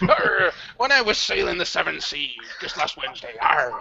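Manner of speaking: hammed-up sea dog; pirate voice. Shown as a demonstration. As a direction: growl it like a pirate. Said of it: "Talk Like a Pirate Day".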